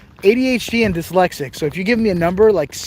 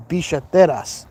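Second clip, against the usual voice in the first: Argentine Spanish, double l as sh; Argentine accent. Shown as a demonstration. Vlogger showing viewers the Spanish word for wallets, Argentine accent. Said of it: "in the accent of Argentina".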